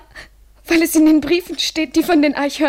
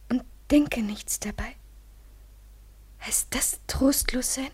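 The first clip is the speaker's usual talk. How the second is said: whisper; 3 semitones lower, 10 dB quieter than usual. Acted. Lovers' intimate whispering.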